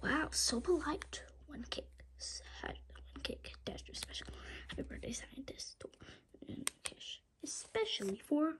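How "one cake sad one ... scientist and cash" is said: soft whispered commentary. Acted. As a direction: whisper while hiding and watching. Hiding, whispering while watching.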